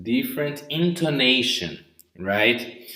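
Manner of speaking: usual speaking voice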